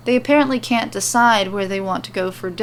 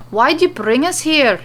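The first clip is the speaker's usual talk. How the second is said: raised, louder voice; loud question; 6 semitones higher, 5 dB louder than usual. Acted. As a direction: call it out, echoing in a big room. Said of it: "Nikki questions loudly".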